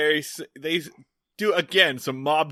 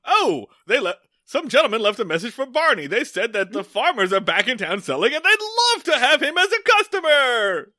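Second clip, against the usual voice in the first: high, loud dopey Floyd voice, climbing even higher at the end; goofy Floyd; 10 semitones higher, 6 dB louder than usual. Retold or imitated. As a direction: relay the message like a cheerful oblivious barber. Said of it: "goes to jail and is just like".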